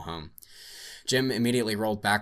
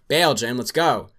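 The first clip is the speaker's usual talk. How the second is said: urgent shout to a partner; 5 semitones higher, 11 dB louder, slower than usual. Acted. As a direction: yell it, jumping out. Yelling to partner to bail out.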